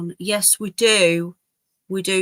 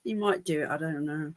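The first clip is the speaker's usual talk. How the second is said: ordinary talk, nothing marked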